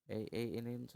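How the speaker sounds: normal talking voice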